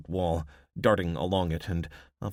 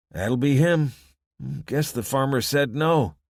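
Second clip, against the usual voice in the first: a lightly voiced wary local; weary small-town man, half-reluctant; rough townsman voice; 7 semitones higher, 7 dB louder than usual. Townsman hears gunfire.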